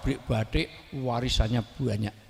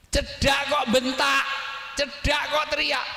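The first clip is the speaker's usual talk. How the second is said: demonstrated shout; 11 semitones higher than usual. Shown as a demonstration. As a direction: yell it as angry people do at close range. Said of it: "pasti teriak. Dua, bentak". (teriakan membentak, bernada tinggi)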